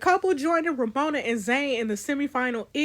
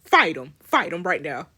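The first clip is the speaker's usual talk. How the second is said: ordinary delivery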